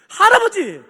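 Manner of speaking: put-on high, loud calling of the old man; loud acted manager's announcement; emphatic address. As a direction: call out to get his attention. Manager's firm announcement to customer.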